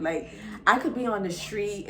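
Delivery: usual speaking voice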